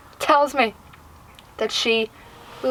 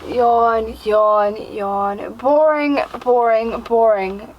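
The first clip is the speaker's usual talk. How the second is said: drawn-out bored chant; mock-bored droning of each word; bored mockery; 3 semitones lower, much slower than usual. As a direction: act out being bored stiff. Voicing the bus's boredom.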